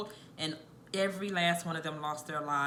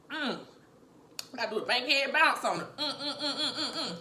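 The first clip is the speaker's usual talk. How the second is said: higher, laughing hum; laughing through the burn; 7 semitones higher than usual. Real, not acted. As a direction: laugh and hum while bouncing to fight the heat.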